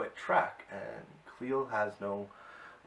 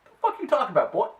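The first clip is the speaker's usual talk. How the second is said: much higher, much louder incredulous shout; hammed-up hero yelling at a fool; 12 semitones higher, 13 dB louder, much faster than usual. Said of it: "He's like".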